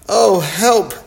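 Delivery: high, loud panicked cry; frightened cry for help. Acted. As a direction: shriek it as the ground gives way. Buggy falls into a hole.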